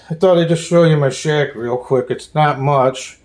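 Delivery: ordinary delivery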